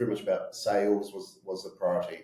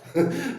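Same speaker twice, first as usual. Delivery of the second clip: burst of laughter; 5 dB louder than usual. Real, not acted. Laughs after swearing about ambition.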